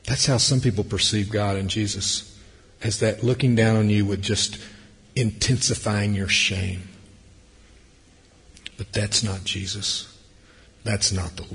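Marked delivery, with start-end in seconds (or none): none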